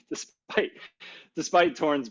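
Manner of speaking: barely voiced, breaking into a laugh. Real, not acted. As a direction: try to read on through a laugh. Laughs reading model's false claim.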